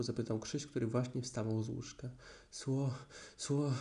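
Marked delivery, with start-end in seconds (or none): 2.5-3.7 breathless stammer. Acted. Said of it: "bełkotał prosiaczek tak zdyszany".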